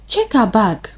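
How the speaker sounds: plain reading voice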